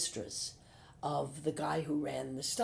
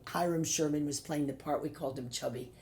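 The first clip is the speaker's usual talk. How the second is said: ordinary talk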